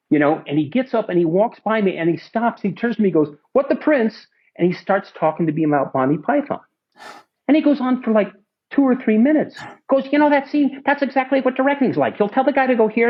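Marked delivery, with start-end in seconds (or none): 3.5-4.3 high mock British bit; Marty's Python line. Director quoting Monty Python, high playful impression. 10.1-13.1 high, eager impression; imitating Marty explaining. Director lecturing about Python, high eager impression.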